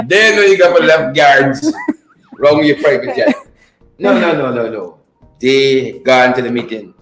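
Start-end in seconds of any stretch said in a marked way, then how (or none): none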